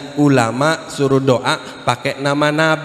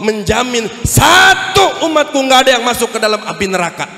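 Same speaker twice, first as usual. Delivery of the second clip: impassioned refusal; 9 semitones higher than usual. Acted. Prophet swearing to Jibril he will not give up his people, impassioned. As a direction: plead and refuse with rising force.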